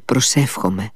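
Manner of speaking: usual speaking voice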